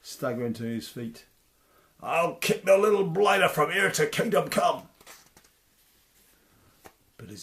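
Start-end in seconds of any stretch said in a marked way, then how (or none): 2.0-4.9 loud, higher giant voice; angry giant vow; giant's threat. Acted. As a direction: threaten with wounded pride.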